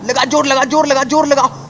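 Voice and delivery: high urgent egging on of लगा जोर; egging the truck on; urging on. Real, not acted. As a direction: push it on with your voice.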